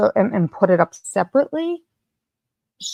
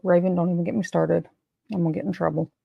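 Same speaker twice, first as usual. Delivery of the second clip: ordinary talk, nothing marked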